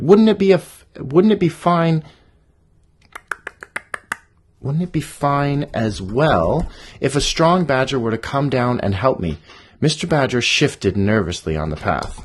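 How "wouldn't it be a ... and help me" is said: loud, biting sarcasm fading; sarcastic anger. Acted. Bunny goads the badger, loud and sarcastic. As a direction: needle him pointedly.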